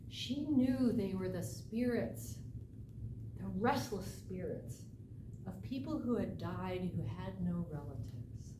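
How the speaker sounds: usual speaking voice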